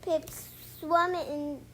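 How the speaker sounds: ordinary delivery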